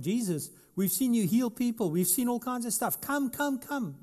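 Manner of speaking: high, urgent begging; pleading sisters. Acted. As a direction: beg him to come, voice climbing. Sisters begging Jesus to come.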